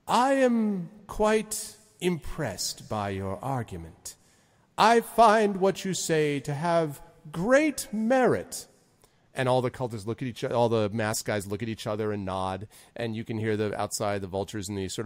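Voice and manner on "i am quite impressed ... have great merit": slow, high-pitched stately lord; Zephyros. Acted. Lord praising Envon's argument, slow and stately. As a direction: pronounce judgment slowly and grandly. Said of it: "and Zephyros says".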